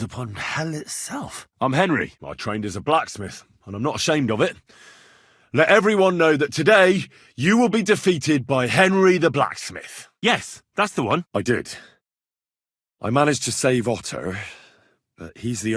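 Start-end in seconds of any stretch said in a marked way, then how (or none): 5.5-10.1 proud challenge. Acted. Challenge before a duel.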